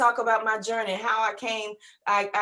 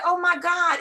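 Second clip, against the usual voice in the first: excited recognition; 6 semitones higher, 4 dB louder than usual. Retold or imitated. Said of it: "she said".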